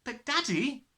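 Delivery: Matilda. Acted. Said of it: "Matilda began".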